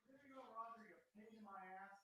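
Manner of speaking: muttered from across the room; distant aside to the dog; off-mic grumbling. Real, not acted.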